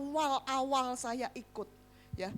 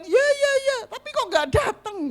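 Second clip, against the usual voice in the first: dismissive reply; 12 semitones higher, 6 dB louder than usual. Retold or imitated. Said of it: "Mereka bilang".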